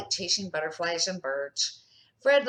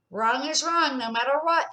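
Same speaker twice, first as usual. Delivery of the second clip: put-on high, loud kid's firm motto; a child's proud chant; firm child declaration; 7 semitones higher, 5 dB louder than usual. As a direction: declare it like a rule. Emily firmly declaring.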